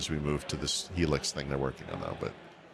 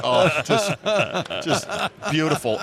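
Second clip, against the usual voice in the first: mock delighted; 7 semitones higher, 4 dB louder than usual. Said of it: "I was just like".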